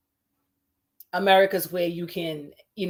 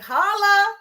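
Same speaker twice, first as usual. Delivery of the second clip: playful greeting; 11 semitones higher, 6 dB louder than usual. Pretend. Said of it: "maybe I should just be like".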